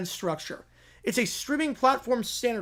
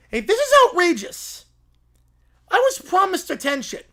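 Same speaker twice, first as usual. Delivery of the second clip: loud, high indignant protest; outraged complaint; 8 semitones higher, 6 dB louder than usual. Acted. Plaintiff protesting to the court, loud and outraged. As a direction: whine for the spotlight.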